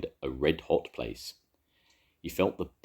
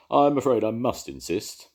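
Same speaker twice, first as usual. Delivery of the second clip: louder, officious insistence; firm stationmaster; 7 semitones higher, 6 dB louder than usual. A narrator's reading. Di insisting the dragon use the basket, firmly. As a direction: stand firm on the rules. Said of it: "said Di".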